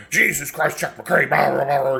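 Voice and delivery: high-pitched mock tirade; hammed-up exasperated nagging; scolding mom. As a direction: imitate mom chewing dad out, tumbling into babble. Mom scolding dad, retold.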